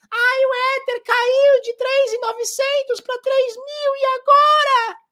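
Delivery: very high, slow falsetto whine; mock panic. Mocking the investor panicking over the Ether drop, in a high whine. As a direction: fret in a squeaky, terrified voice.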